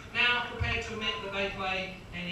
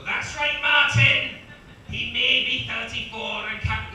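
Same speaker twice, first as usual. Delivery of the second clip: loud, higher-pitched attempted Scottish accent; Scottish co-commentator; 4 semitones higher, 11 dB louder, much slower than usual. Co-commentator replying to Martin in his head, loud Scottish voice. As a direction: belt out punditry in a shaky Scottish accent. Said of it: "he's Scottish".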